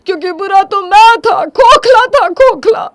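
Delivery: very high, loud tearful cry; sobbing self-blame. Acted. Husband confessing his faults to his wife, sobbing loudly. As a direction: break down, crying the words out. Breaks down blaming himself.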